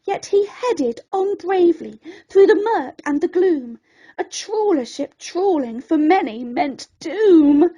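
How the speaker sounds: ordinary delivery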